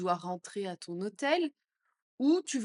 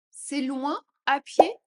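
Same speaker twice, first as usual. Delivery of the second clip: louder, slower and higher; 5 semitones higher, 9 dB louder, slower than usual. Shown as a demonstration. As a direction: call it out slowly. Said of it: "phrase modelled twice". (plus fort, plus aigu, lent)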